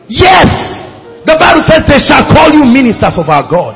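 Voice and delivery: loud, high shout of affirmation; preaching shout. Real, not acted. Preacher shouting scripture at the congregation, loud and high. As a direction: burst out and proclaim.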